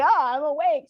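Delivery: high, little creature voice from the pillow; squeaky pet voice; sleepy opossum voice. Retold or imitated. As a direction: lightly voice the opossum waking.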